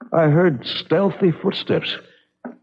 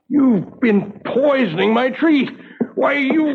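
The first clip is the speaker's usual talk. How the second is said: furious outburst; 12 semitones higher than usual. Acted. Husband rounding on his wife, furious and shrill. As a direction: rage breaking out at the betrayal.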